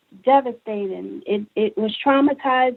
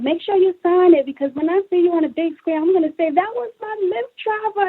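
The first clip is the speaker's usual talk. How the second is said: excited fan; 8 semitones higher, faster than usual. Retold or imitated. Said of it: "I have people saying".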